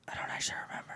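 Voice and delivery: barely voiced, very soft aside; near-silent thinking aloud; whispered aside. Real, not acted. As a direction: whisper it to yourself while trying to recall. Aside while trying to recall a name.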